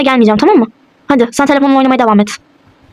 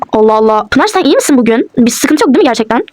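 ordinary delivery

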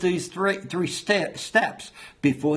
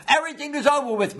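high, rushed exclamation of defeat; acted giving up; 10 semitones higher, faster than usual. Someone throwing in the towel to God, acted exasperation. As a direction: throw it out fast in a raised voice.

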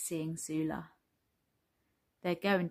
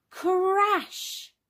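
high, drawn-out crash with rolled r; high dramatic sound-effect word; storybook crash effect; 11 semitones higher, 4 dB louder than usual. Acted. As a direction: exclaim the crash of falling blocks. Sound effect of blocks tipping.

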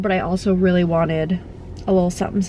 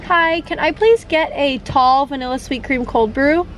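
higher, bright ordering voice at the speaker; polite customer voice; 6 semitones higher than usual. Real, not acted. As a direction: pitch up, friendly drive-thru order. Ordering at drive-thru speaker.

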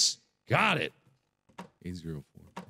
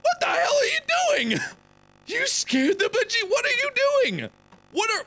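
startled yelling; 13 semitones higher than usual. Real, not acted. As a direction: yell in real shock at the lady. Startled by the lady appearing.

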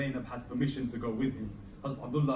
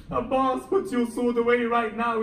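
louder and higher, insistent order; urgent command; 8 semitones higher, 8 dB louder than usual. Acted. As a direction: press the order harder, almost a shout. Hussein repeats the order.

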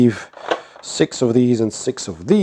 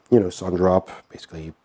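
ordinary delivery

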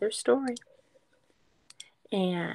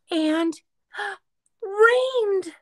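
surprised; 11 semitones higher than usual. Acted. Gasp at rain.

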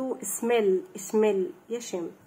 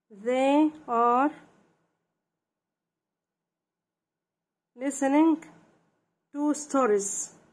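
word-by-word dictation; 3 semitones higher, much slower than usual. Shown as a demonstration. Teacher dictating sentences to children, word-by-word dictation. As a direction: dictate in small, lifted pieces.

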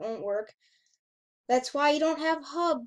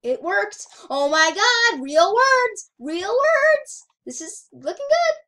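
loud, high cheering; overjoyed outburst, climbing higher; excited celebration; 7 semitones higher, 11 dB louder, slower than usual. Real, not acted. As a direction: shout it in delighted disbelief. Celebrates readable words in the game.

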